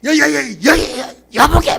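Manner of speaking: loud, high, stuttered outburst; worked-up husband tripping over his first word; angry stammering. Retold or imitated. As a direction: stammer in mock fury at a spouse. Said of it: "말 더더더더 하잖아요".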